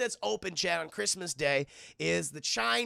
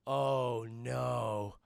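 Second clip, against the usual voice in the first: mock dismay; 7 semitones lower than usual. Kid realizes glasses are crushed.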